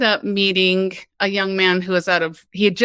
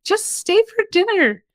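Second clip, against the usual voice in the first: sweet mom voice; 12 semitones higher than usual. Retold or imitated. Sweet mom invites her.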